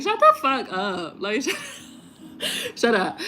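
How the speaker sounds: too amused to finish the telling-off; laughing exasperation. Real, not acted. Venting about bogus manifestation posts, laughing. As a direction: shut up collapsing into giggles. Cracking up at her own rant.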